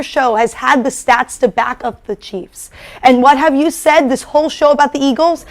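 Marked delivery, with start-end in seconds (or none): none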